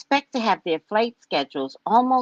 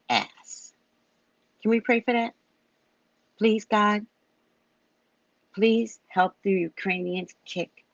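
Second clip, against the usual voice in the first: mock prayer; 4 semitones lower, 4 dB quieter, slower than usual. Asks viewers to pray.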